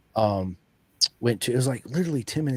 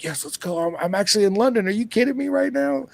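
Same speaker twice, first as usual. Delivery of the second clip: giddy disbelief, pitched way up; thrilled; 12 semitones higher, 3 dB louder than usual. Retold or imitated. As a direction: voice jumping much higher in glee. Arriving in London, thrilled.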